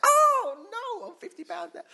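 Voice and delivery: high, loud squeal of dismay; mock dismay. Imagined person loses the money, in high mock dismay. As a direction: wail as the money is taken back.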